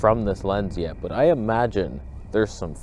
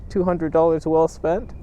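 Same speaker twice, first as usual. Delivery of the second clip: ordinary talk, nothing marked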